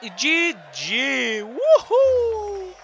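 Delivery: slow shouted E G G, then a high whoop; victory cheer. Real, not acted. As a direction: cheer the win out loud. End of match celebration.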